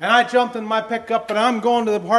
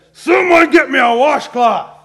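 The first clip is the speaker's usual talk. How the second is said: high, loud, slow bark for a washcloth; demanding yell; 6 semitones higher, 12 dB louder, slower than usual. Retold or imitated. Husband with dirty sleeves yelling at family for a washcloth, loud. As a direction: call out sharply, annoyed. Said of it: "and I said".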